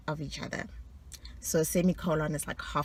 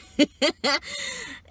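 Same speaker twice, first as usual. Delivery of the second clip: high, loud pealing laugh; boastful laugh; 11 semitones higher, 4 dB louder than usual. Real, not acted. Bragging about her quiz score, a high laugh.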